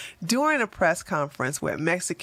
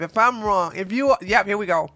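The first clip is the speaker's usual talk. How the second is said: ordinary delivery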